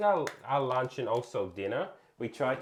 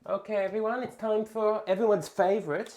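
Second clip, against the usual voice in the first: higher-pitched hype intro; playful host announcement; 7 semitones higher than usual. Pretend. Streamer introducing everyone's favorite segment, playful announcing.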